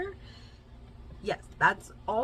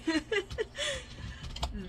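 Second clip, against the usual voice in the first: amused laugh; 13 semitones higher than usual. Real, not acted. Laughs at herself.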